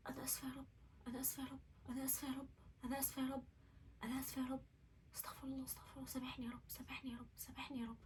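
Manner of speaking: very soft, repeated plea to God; small, hushed begging for forgiveness; hushed repentant prayer. Retold or imitated. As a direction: murmur an apology over and over, barely voiced. Retold repentant prayer after awakening.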